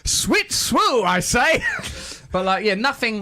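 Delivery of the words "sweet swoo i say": mock shout. Mock posh exclamation.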